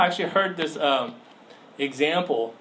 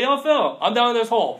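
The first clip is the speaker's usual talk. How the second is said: higher, louder hail from the pit; calling to Phil; 7 semitones higher, 5 dB louder than usual. Acted. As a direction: yell up to your friend walking by. Calling friend from a hole.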